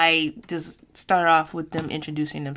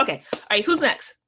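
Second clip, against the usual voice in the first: normal talking voice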